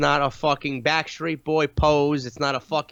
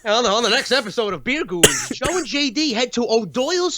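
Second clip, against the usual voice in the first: mock promo announcer; 4 semitones higher than usual.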